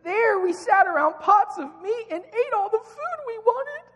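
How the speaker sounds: very high, wailing whine that cracks into a cry; hammed-up whiny complaint, breaking into a sob. Israelites wailing at Moses in a very high whiny voice. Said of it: "You gotta read it with a whiny voice".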